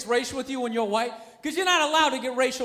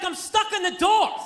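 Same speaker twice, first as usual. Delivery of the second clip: high, drawn-out indignant whine of protest; mock outrage, stretched out; flipping out; 5 semitones higher, slower than usual. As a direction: imitate an exasperated man stating the obvious. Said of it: "starts flipping out".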